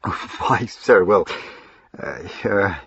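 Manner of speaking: flustered, halting stammer; embarrassed stammer. A narrator's reading. Servant caught out about his sweetheart, stammering. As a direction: trip over the words, caught out. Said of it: "Salter blushed and stammered hopelessly".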